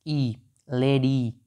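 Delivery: the ई at the end of लेडी stretched slowly; long ee vowel. Shown as a demonstration. Teacher asks students to listen, slowly stretched ee. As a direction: draw out the final ee in लेडी. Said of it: "आवाज़ को थोड़ा सुनो".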